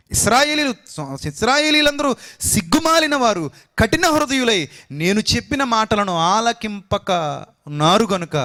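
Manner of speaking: high, loud rebuke of the stubborn Israelites; preaching at full force. Acted. Preacher to his congregation, voicing God's stern rebuke, loud and high. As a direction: thunder it out from the pulpit, slow at the end.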